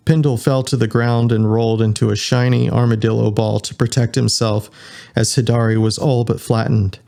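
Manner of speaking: ordinary delivery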